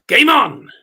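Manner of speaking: loud, high game on call; darts caller. Retold or imitated. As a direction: bellow it like a darts referee. Calling out start of darts leg.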